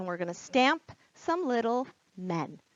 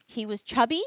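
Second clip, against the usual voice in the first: put-on lively read-aloud for children; teacher reading The Night Before Christmas to kids, animated; storybook reading; 2 semitones higher, 4 dB louder than usual. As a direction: read it with wide-eyed wonder, like bedtime.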